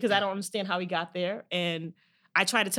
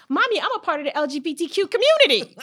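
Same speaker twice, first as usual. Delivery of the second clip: put-on high, laughing little boy voice; laughing through a child's proud line; imitating her young son; 8 semitones higher, 6 dB louder than usual. Mom retelling her son's answer in his high voice, laughing. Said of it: "He said".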